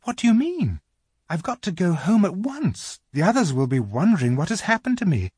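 put-on high, anxious girl's voice; rather frightened. A narrator's reading. Lucy insisting to the faun she must go home, rather frightened. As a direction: protest with mild alarm, high. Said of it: "feeling rather frightened".

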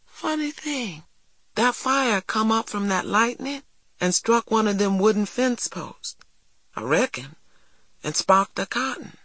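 a hint of an eager farm boy, quick; earnest young boy, lightly voiced; lightly voiced country boy. Jeremy's voice, musing.

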